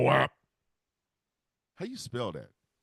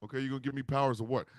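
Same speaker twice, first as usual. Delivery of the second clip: ordinary talk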